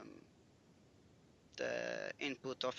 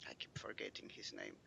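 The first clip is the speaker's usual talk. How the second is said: whisper; 12 semitones lower, 15 dB quieter, faster than usual. Real, not acted. Aside to the table.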